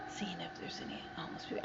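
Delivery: quick whispered aside. Real, not acted. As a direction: whisper it quickly under the breath. Aside about homeless people.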